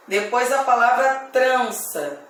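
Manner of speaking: ordinary delivery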